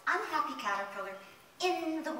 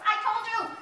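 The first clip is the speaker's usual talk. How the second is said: high, loud tantrum; storming mad; 11 semitones higher, 7 dB louder than usual. Acted. Caterpillar protests to the fairy after the last wish, storming mad. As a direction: stomp out each protest like an enraged child. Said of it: "he is just storming mad".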